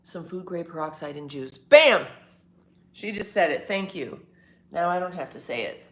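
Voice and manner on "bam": loud, high, punchy exclamation; triumphant bam. Real, not acted. Celebrating when someone else said it first. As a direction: shout it out in triumph.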